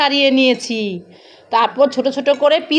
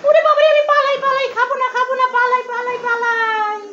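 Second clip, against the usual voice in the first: much higher, laughing cries of running away; mother plays a scared little one running from food, laughing; fleeing mock panic; 8 semitones higher than usual. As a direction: ham up a silly escape from the spoon.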